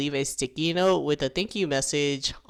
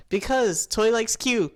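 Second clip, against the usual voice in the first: higher, louder blurt; 7 semitones higher, 4 dB louder than usual. Retold or imitated. Matt blurting out Toy's secret to everyone, loud. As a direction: spit the secret out all at once. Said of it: "Matt just blurts out".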